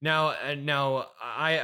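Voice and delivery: ordinary talk, nothing marked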